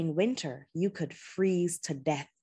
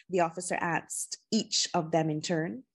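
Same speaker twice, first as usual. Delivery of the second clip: ordinary delivery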